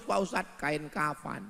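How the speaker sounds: normal talking voice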